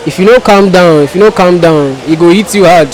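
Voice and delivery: usual speaking voice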